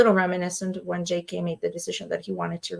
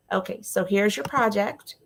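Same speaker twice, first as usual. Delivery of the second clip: ordinary talk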